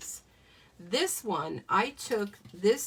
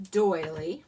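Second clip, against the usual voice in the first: doily said louder with a rising lilt; stress on doily; 3 semitones lower, 5 dB louder than usual. Shown as a demonstration. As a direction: lean into "doily" and lift it up at the end. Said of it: "Do you love how I'm, like, emphasizing the doily?".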